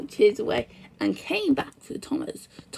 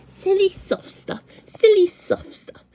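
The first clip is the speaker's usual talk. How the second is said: louder and higher, slowing into a scornful boast; scornful puff; 8 semitones higher, 7 dB louder than usual. A narrator's reading. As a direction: puff it out, proud and dismissive of the snow. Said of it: "Buffy puffed".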